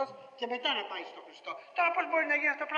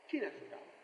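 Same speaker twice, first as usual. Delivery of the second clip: softer, lower sigh; helpless, hushed question; quiet lament; 9 semitones lower, 10 dB quieter than usual. Acted.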